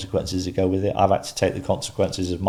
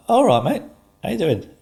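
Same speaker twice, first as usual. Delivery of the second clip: bright, much higher, chirpy hello; breezy mock greeting; 12 semitones higher, 3 dB louder than usual. Answering the angry pundit's call, breezy and cheerful. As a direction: play it casual and cheerful on the phone. Cheery reply to unknown caller.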